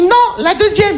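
high, prim correction; high mock assistant. Assistant pointing to the gynaecology chair, high and prim. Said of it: "Elle m'a dit".

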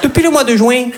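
put-on high, bright-pitched Midi accent for a romcom; southern French accent. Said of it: "avec l'accent du Midi".

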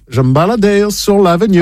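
slow French with an American accent; American-accented French, unhurried. Shown as a demonstration. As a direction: put on a drawn-out American accent. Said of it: "Je vous le fais avec l'accent américain".

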